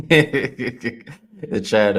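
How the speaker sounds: words squeezed out through a real laugh. Real, not acted. Host reading a funny chat comment, laughing through it. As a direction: still laughing as he starts talking.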